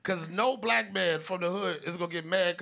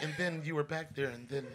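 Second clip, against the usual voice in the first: soft, softer interviewer voice; imitating an interviewer; 3 semitones lower, 5 dB quieter than usual. Oprah-style interview of comedian friend, softly coaxing. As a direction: coax gently like a TV interviewer. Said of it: "Oprah Gayle King".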